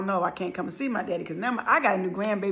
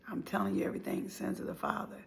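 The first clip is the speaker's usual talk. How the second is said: usual speaking voice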